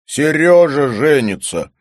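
loud, high, drawn-out good news; jubilant announcement. A narrator's reading. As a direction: proclaim it joyfully and slowly. Jubilant announcement after cheering.

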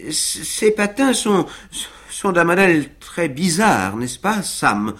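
high, halting nervous question; wobbling Winkle. A narrator's reading. Winkle tottering on skates, asking Sam nervously. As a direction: stall while trying not to fall. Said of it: "demanda monsieur Winkle chancelant".

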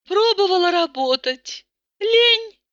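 lightly voiced plaintive Tata; a hint of whiny self-pity; whining complaint. A narrator's reading. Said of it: "продолжала плакаться Тата".

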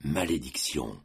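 very low, soft curse; said under his breath. A narrator's reading. Matteo curses his son under his breath, quiet. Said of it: "dit tout bas Matteo".

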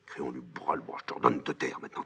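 normal talking voice

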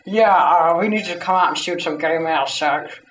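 usual speaking voice

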